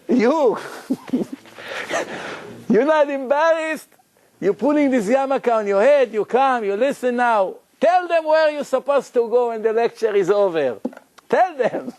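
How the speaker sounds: high, indignant retold rebuke; scolding. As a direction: imitate an incensed man shaming another. Retold scolding of the Israeli guy.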